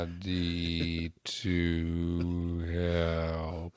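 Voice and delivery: extremely slow, low drawn-out words; slow motion. Pretend. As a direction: stretch every word like a slow-motion replay. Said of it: "Slow motion for you".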